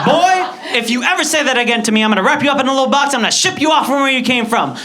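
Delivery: high, loud scolding threat; angry mother. Acted. Mother warning her son never to say it again, angry.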